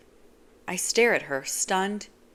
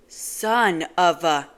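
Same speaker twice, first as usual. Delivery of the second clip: slow, loud outburst cut short; angry cry; 7 dB louder, much slower than usual. Acted. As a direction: bite off the curse in pain. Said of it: "I cut myself off with an angry cry".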